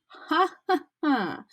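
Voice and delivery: high gleeful cackle; mocking cackle. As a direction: cackle away. Peeves cackling.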